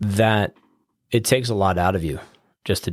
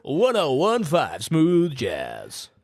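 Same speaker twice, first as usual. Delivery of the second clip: slow, higher, drawn-out station ID; hammed-up jazz radio announcer, stretching the words; smooth jazz DJ; 7 semitones higher, much slower than usual. Guest taking the toss for a station ID, smooth DJ voice.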